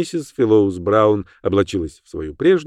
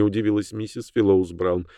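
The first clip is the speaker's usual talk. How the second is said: plain reading voice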